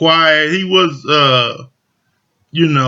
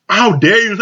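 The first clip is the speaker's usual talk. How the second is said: mock outrage; 5 semitones higher, 3 dB louder than usual. Retold quarrel, Simone offended.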